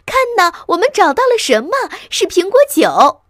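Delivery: put-on high child's squeal of discovery; loud and excited. A narrator's reading. Little fox has found the cider, excited shouting. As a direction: shout the find to everyone. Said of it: "他大声说".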